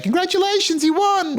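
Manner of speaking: high bright mock welcome party, cheering; sing-song hammed-up welcome; cheery greeting. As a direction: play the staff who greet you with congratulations. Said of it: "procession of people saying".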